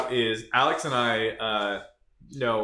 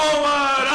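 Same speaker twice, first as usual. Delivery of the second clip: loud laugh; 13 semitones higher, 5 dB louder than usual. Real, not acted. Laughing in character.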